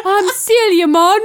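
high, loud Jamaican parody; mock Jamaican accent. Celia introducing herself in a laughing mock-Jamaican accent. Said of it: "Celia with her accents".

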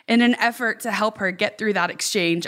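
usual speaking voice

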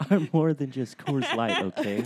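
laughing. Pretend. Laughing echo.

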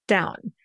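"down" falling like a period; going down. Shown as a demonstration. Coach labelling the last name's tune, falling. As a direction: land "down" with a final falling tone. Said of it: "And then our last name, go down".

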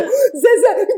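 high, sobbing call of a name; imitated crying. Actor retelling how she broke down calling Zezé, mock crying. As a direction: act out bursting into tears on the name. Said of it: "e eu comecei a chorar".

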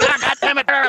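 high mock promo rant; high-pitched imitated cursing; imitated angry promo. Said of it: "he's cutting this promo".

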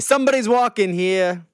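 high, brash New York cabbie voice; New York street guy. Pretend. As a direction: yell it like a Noo Yawk local. Joking New York street retort.

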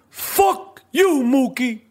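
the movie pizzeria owner cursing; Danny Aiello impression. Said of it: "What was some of his other lines?".

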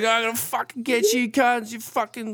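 high, mocking furious woman; imitating an angry drunk woman; mock drunk rage. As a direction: ham up a drunk lady's threat. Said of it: "she was going off".

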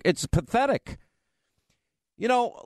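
ordinary talk

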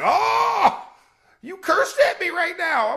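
very high, loud mock wail; retold outburst. Frenzied player reacting to his teammate, wailing comically. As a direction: cry out in exaggerated shock.

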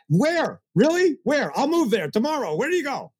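worked-up incredulity. Real, not acted. Mocking Secret believers to the host, incredulous and worked up. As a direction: scoff at the idea, pitch soaring. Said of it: "I get a little worked up".

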